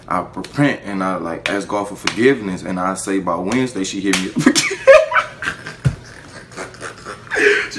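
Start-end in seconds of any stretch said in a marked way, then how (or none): 4.6-7.7 high squealing burst of laughter; real high-pitched laugh. Real, not acted. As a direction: crack up at the twist. Laughing at the punchline.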